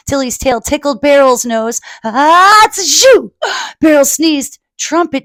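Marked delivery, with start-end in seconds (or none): 2.0-3.3 loud high comic sneeze. Acted. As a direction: build up and sneeze on shoo.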